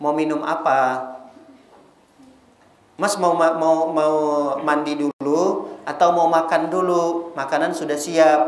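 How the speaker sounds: wife greeting her husband gently after work; tender wife. Retold or imitated. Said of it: "Harusnya kan begitu".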